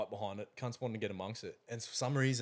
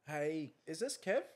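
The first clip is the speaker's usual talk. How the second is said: lightly voiced woman caller, higher pitch; slow, higher-pitched imitation of a caller; 5 semitones higher, slower than usual. Woman phoning about a dog ad, lightly imitated caller. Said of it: "it was a Sheila".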